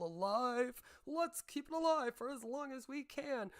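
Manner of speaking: high, gleeful mock scheming voice; mocking greedy drama streamers; mocking schemer. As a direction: plot it like a cheerful villain.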